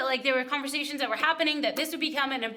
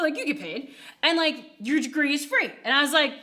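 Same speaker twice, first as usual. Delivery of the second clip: ordinary talk, nothing marked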